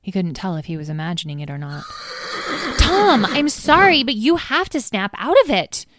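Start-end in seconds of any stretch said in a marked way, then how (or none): 1.9-2.7 quick startled gasp; a sudden breathy gasp of surprise; sharp gasp. Acted. Tom jolted from his daydream, a startled gasp. 2.8-5.9 higher, louder exasperated scold; impatient scolding. Acted. Edwene scolding daydreaming Tom at braiding, impatient and loud. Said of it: "Edwene stamped her foot".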